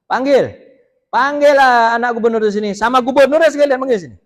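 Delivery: loud, high summons; commanding shout. Retold or imitated. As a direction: bark the order to fetch them now. Umar orders son summoned.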